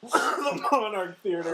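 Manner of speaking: slow, high, laughing echo; laughing mock repeat. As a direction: repeat it slowly while cracking up. Mock-repeating friend's phrase, laughing.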